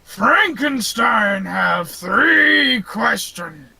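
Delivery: slow, lumbering monster shout; huge, drawn-out broken-English monster voice; Frankenstein's monster. Acted. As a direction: boom it out like a giant corpse man. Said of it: "giant behemoth of a corpse man".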